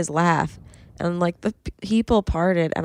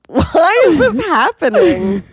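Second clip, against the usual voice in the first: baffled outburst; 11 semitones higher, 8 dB louder than usual. Retold or imitated. Imitating confused listeners in cars.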